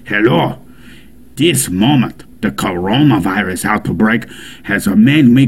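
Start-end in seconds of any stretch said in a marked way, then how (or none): none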